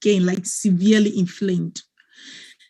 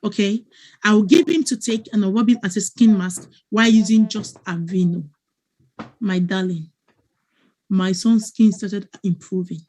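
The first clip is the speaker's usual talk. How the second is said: ordinary talk, nothing marked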